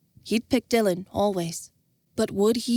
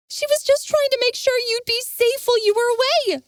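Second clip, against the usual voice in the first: much higher woman, heated; arguing; 4 dB louder than usual. Acted. Jenna arguing with Envis, heated. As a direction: argue back for her friend. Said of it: "Jenna argued".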